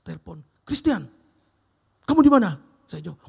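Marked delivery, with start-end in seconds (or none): 0.7-2.6 loud, higher call down the phone; demanding boss. Retold or imitated. Big boss phones employee, loud and demanding. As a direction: bark the question like the boss.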